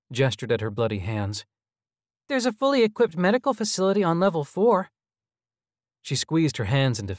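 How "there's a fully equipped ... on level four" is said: put-on high, eager little-boy voice; persuading child. A narrator's reading. Child hologram offering food and rest, high and coaxing. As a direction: coax them in a high child's voice.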